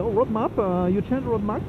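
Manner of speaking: quoting the British rider in a hint of his English accent; imitating British accent. British biker asking if he changed his roadmap, mock British accent. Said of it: "ils ont un putain d'accent".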